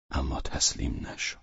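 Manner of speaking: ordinary delivery, nothing marked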